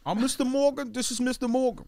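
high, goofy sitcom introduction; Tracy Morgan. Acted. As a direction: introduce yourself as an oddball comic. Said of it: "idea of the Tracy cast".

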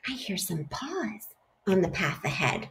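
frightened whisper. Acted. Gruffalo warns the mouse in a nervous whisper. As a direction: breathe it so no one hears.

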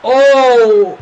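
loud, high cries of alarm; excited shouting. Real, not acted. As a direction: shout through the save. Goalkeeper big save.

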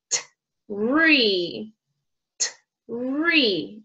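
"t-, re" with a crisp t, a pause, then a long "re"; slow phonics demo of "t-, re"; two sounds separated. Shown as a demonstration. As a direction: split tree into "t-" and "re". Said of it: "it has two sounds together".

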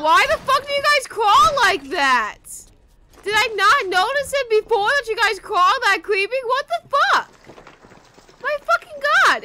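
loud, high disgusted shouting; loud, high freaked-out cursing; shocked yelling. Real, not acted. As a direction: yell at how creepy they crawl. Creeped out by crawling enemies.

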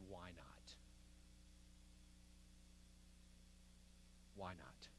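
low, barely audible challenge; hushed question. Acted. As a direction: let it drop very soft and low, then leave silence. Admiral's hushed reply after long pause.

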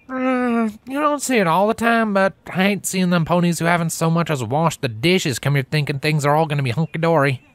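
high, lilting country mare, firm; a country mare warning a city pony; Applejack's country voice. Acted.

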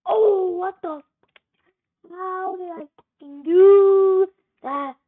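loud, high, disbelieving shout; yelling in shock at his own move. Real, not acted. Gamer yelling at his screen in disbelief, loud and high.

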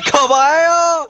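high, loud, drawn-out yell; exasperated shout. Real, not acted. Player yelling at a teammate in disbelief, exasperated shout. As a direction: holler it out, stretching the vowels.

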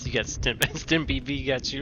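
usual speaking voice

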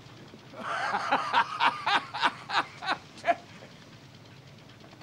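long high laugh; incredulous laugh. Acted. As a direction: burst out laughing in disbelief. Captain laughs at the offer.

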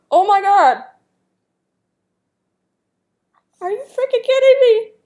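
loud high disbelieving outcry; shocked disbelief. Real, not acted. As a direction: shriek in stunned surprise at the guess. Akinator finally guesses the character.

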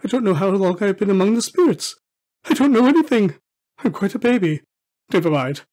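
excited, quick start easing to carefree; giddy babbling. Acted. Scrooge back from the spirits, giddily carefree. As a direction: exclaim it with happy abandon.

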